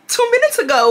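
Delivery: high, laughing re-enacted outrage; mock outrage breaking into laughs; incredulous. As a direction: argue back in high-pitched disbelief. Acted quarrel, own incredulous comeback.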